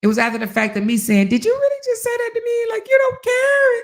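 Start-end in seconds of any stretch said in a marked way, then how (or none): 1.3-3.7 high, fast, pitched-up cry of hurt; shrill hurt wife. Retold or imitated. To her husband, a high wounded mock cry. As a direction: whine at him like a wounded wife.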